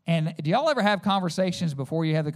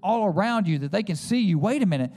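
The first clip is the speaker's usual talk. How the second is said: louder, rising sermon over music; impassioned preaching; 3 semitones higher, 3 dB louder than usual. Acted. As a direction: build the fervour to the altar call.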